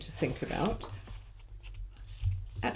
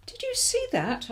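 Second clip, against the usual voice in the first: much higher, startled question; surprised mother. Acted. Mother startled seeing baby Jane bounce, surprised high question. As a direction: gasp it out in surprise. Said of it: "asked her mother in surprise".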